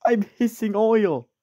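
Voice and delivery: high, drawn-out exclamation; high-pitched character cry. Acted. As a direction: call it out high and slow, in character. Acting out a hissing engine's lines.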